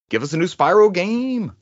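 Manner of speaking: ordinary delivery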